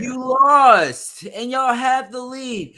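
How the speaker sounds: high, slow, mock disbelief; incredulous mock tone. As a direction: needle him with exaggerated disbelief.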